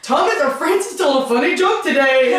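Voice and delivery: high, loud yelling movie voice; Will Ferrell movie quote. Retold or imitated. Parodying Francis on the stand, in a high, loud hammed-up yell. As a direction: shout it like a wounded comedy hero. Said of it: "that Will Ferrell movie that was like".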